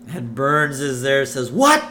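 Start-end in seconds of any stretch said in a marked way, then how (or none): 1.5-1.8 high, loud, rising demand; affronted outburst. Acted. Boss hearing himself insulted, loud affronted question. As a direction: snap back in mock offence.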